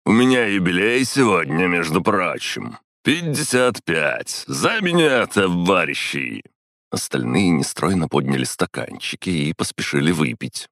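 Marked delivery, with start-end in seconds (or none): 0.0-6.4 put-on high, slow drunken cheer; tipsy man raising a toast. A narrator's reading. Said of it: "раздался пьяный голос Орлова".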